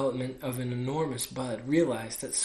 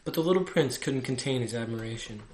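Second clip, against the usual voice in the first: ordinary delivery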